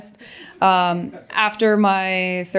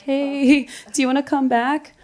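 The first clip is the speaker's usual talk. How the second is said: high, singsong mock invitation; mock coaxing; 7 semitones higher than usual. Old employer asking her to return, mock coaxing. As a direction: imitate a sheepish ex-employer wheedling, pitched up.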